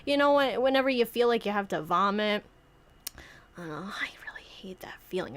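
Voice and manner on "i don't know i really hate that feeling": whispered aside. Real, not acted.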